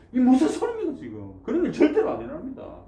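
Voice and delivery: high, loud protest with a laugh in it; mock scolding. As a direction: Peter half-laughing as he scolds his master. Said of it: "막 뭐라 하는 겁니다".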